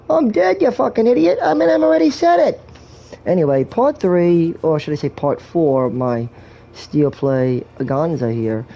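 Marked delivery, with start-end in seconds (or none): 0.1-2.6 high, loud squeaky dead-guy voice; mock dead guy. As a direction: squeal it like a cranky corpse. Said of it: "Like this".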